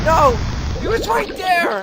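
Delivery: high, loud cry of frustration; frustrated outcry. Real, not acted. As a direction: yell it out at the miss. Missed shot, outcry.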